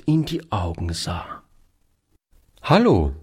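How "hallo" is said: brief raised call of greeting; mildly called-out hello. A narrator's reading. Gentleman hails a boy he recognises, lightly calling out. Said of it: "rief er".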